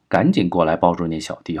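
plain reading voice

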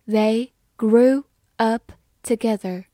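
slow and deliberate, syllable by syllable; slow practice read. Shown as a demonstration. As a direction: read it slowly for learners. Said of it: "好，慢慢来读。".

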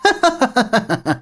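high, gloating burst of laughter; loud triumphant laugh. A narrator's reading. Traitor laughs in triumph over his captured master. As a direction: laugh down at the trapped lord. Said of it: "徐老罗大笑道".

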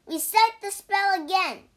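high, loud urging; insistent, loud command; urgent demand. Acted. Pig urges punishment again.

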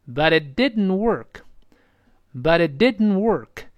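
slow "but it didn't work" with "but it" joined; slow teacher's model of "but it didn't work"; linked model reading. Shown as a demonstration. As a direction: join "but it", release "didn't" through the nose. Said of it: "试试鼻腔爆破".

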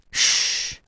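long hushing "shh"; whispered shush. A narrator's reading. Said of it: "whispered Buster".

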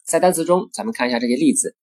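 ordinary talk, nothing marked

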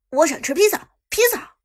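high, loud eager request; excited. Acted. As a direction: blurt it out, greedy and thrilled. Eager food request, repeated.

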